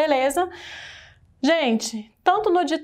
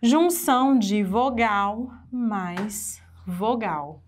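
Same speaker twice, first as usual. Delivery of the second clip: Junção de vogal mais vogal, each word weighed; slow, deliberate definition; slower than usual. Shown as a demonstration. Teacher dictating the definition slowly. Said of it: "que que é hiato?".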